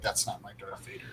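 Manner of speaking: a hushed mock fan complaint; whispered fan quote. Podcaster whispering a mock fan complaint.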